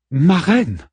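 high, insistent call to the queen; Brémusa calling out. A narrator's reading. Warrior calling her distracted queen again, insistent call. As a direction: call out sharply to your queen. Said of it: "se tourna vers Brémusa".

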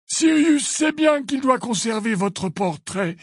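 high, slow, deliberate voice; measured, high-pitched rebuttal; Dumbledore, firm. A narrator's reading.

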